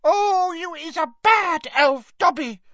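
high, loud moaning elf reproach; Winky moans at Dobby, very high and tearful; moaning house-elf. Acted. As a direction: wail a reproach at him. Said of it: "moaned Winky".

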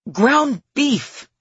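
forced out loud through gritted teeth. A narrator's reading. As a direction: push "Ground beef" out through clenched teeth, loud and high. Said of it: "through gritted teeth".

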